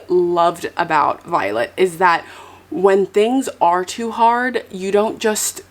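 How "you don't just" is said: ordinary talk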